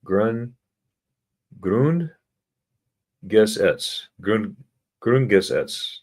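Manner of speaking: "Grund-" rising, then "Gesetz" on its own, then "Grundgesetz" joined together; split into syllables. Shown as a demonstration. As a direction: build "Grundgesetz" piece by piece.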